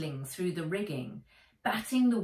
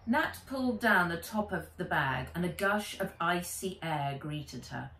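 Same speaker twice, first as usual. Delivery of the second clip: ordinary delivery, nothing marked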